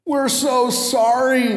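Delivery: high, slow, pleading whine; pleading students. Retold or imitated. Late students pleading with their professor, whiny. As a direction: beg like sorry students. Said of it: "they said".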